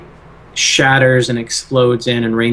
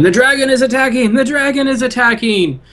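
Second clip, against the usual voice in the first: put-on high-pitched teenage alarm cry; panicked-style yelling; 12 semitones higher than usual. Game master voices teenager warning the abbey, high yelling. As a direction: yell it high, raising the alarm. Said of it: "starts yelling".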